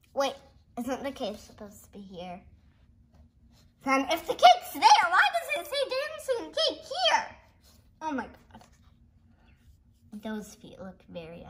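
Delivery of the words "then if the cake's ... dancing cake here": high, loud laughing puzzlement; excited confusion. Real, not acted. Kid confused where the dancing cake is. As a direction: squeal out the question.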